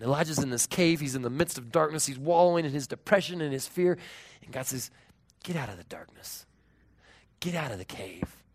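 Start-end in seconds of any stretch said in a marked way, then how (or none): 5.4-8.4 gently but firmly order him out; quiet command. Acted. God calling Elijah out of darkness, quietly.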